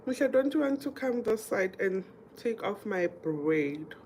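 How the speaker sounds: ordinary talk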